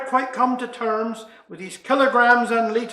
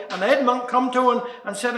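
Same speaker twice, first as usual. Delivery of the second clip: ordinary delivery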